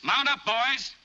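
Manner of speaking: loud, high call to the men; shouted command. Acted. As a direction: bark it out across the yard. Leader calling his gang out.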